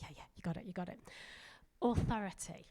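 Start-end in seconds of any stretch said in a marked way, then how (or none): 0.0-1.0 hushed quick reassurance. Retold or imitated. Practice partner reassures the nervous preacher, hushed and quick. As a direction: imitate a partner quietly hurrying you along.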